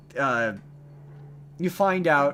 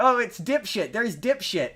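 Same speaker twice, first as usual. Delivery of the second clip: mock greeting; 7 semitones higher than usual. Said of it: "little sketch in my head".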